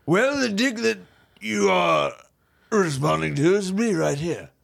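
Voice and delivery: affected upper-crust host voice; grand rich-man manner; pompous rich bachelor. Acted. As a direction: talk like a self-important millionaire.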